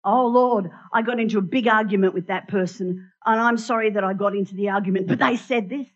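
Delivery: a parishioner caricatured praying with excuses; mock self-justifying prayer. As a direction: overdo the sorry, then blame them. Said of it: "We go to Jesus and we say".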